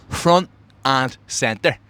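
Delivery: drawn-out smug brag; slow proud boast. Acted. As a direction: stretch every word, savour it.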